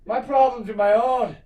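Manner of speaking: high-pitched imitation of someone indignant; mock defensive retort. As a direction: play a touchy man telling someone off, half-laughing. Acted touchy retort to a question.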